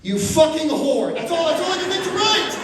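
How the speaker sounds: hammed-up furious outburst; angry email rant. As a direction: explode at the teacher, voice rising. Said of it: "don't send an angry email".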